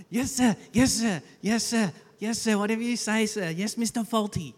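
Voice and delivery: fawning high Manuel impression; eager servant saying yes sir; obsequious. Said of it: "It's a bit like Fawlty Towers".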